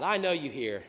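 normal talking voice

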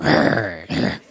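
big rasping growl, then a short one; loud imitated growl. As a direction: growl for the whole church to hear. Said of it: "it wasn't just a little whisper growl".

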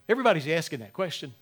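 ordinary talk, nothing marked

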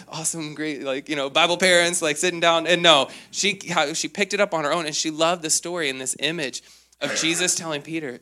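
usual speaking voice

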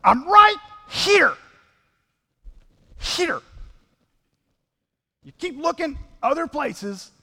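high, loud, slow insistence; exasperated Jesus. Acted. Jesus calling to people looking elsewhere, high loud exasperation. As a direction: call out, slow and emphatic. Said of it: "if I were Jesus, I'd be like".

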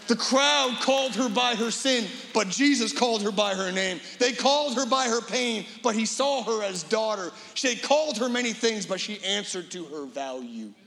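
preaching. Acted. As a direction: ring out each crowd-versus-Jesus line. Preacher's parallel climax lines.

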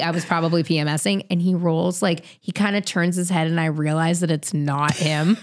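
usual speaking voice

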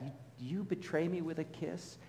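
quiet, wounded question; Jesus quietly rebuking Judas, hurt; hurt soft reproach. Acted. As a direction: softly ask as betrayed friend.

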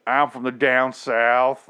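slow, exaggerated Southern drawl. Shown as a demonstration. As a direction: lay the Southern accent on thick. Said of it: "if you're doing the southern drawl".

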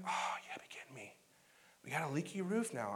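low, breathy groan of disbelief; muttered disbelief. Retold or imitated. Husband groaning to his wife about the leaky roof, low muttered disbelief. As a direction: grumble it under your breath.

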